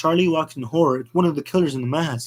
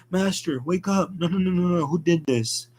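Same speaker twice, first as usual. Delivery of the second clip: 1 distressed high voice; 5 semitones higher than usual. Acted. Rabbit finds dead master.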